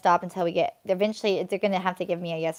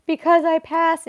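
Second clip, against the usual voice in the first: retold sob; 9 semitones higher, 6 dB louder, slower than usual. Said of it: "I was like".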